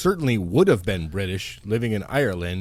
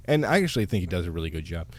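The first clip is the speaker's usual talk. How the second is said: soft, quick aside slipped in under your breath; muttered parenthetical; 7 semitones lower, 5 dB quieter, faster than usual. Real, not acted. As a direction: mutter it fast as an afterthought. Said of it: "you just mutter a parenthetical".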